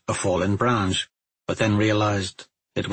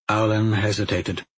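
usual speaking voice

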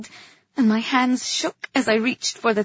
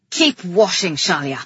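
louder order, dropping low at the end; commanding king; 4 semitones lower, 6 dB louder than usual. A narrator's reading. King ordering his wife to continue, commanding.

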